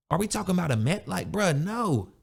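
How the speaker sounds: ordinary talk, nothing marked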